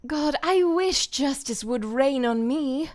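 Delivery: voicing Mercy for a recorded take, slow and earnest. Shown as a demonstration. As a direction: give it slow, weighty yearning as the healer. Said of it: "One more take".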